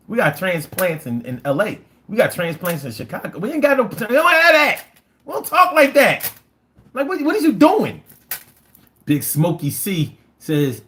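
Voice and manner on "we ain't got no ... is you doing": high, loud protest; indignant. Real, not acted. Local objecting to an outsider's word, loudly indignant. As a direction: object in disbelief with the pitch pushed way up.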